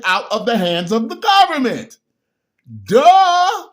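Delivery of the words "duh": high, loud mocking duh; loud sassy duh; sarcastic duh. Real, not acted. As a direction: rub in how obvious it is. Mocking the audience's obviousness.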